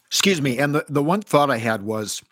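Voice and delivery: coughing on a drink, voice catching; choking on a drink. Real, not acted. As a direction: speak past a choke in the throat. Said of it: "I'm choking".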